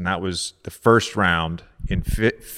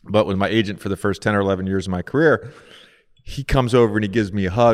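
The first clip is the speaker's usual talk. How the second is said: usual speaking voice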